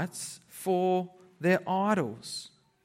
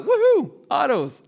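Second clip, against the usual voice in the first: louder mock cheer; 7 semitones higher, 6 dB louder than usual. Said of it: "the next king's like".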